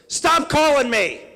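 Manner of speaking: put-on high, loud, slow shrieking old lady; angry old woman. Candidate imitates the old woman at the diner, shrill angry yell. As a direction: screech it like a furious elderly widow. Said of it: "she goes".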